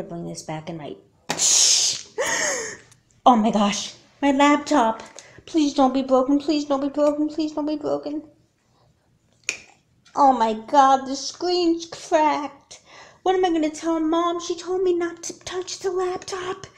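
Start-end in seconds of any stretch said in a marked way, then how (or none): 1.3-2.8 high startled gasp; sharp gasp. Acted. Kid finding the cracked laptop, gasping. 3.2-16.7 high, loud, frantic pleading; rising panic, near tears; panicked. Acted. Kid panicking over the broken laptop, frantic.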